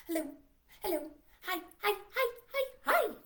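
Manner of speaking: rapid-fire rising hellos and his; quick-fire greeting; fast hellos. Shown as a demonstration. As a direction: rattle them off as fast as you can. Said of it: "hello as fast as we can".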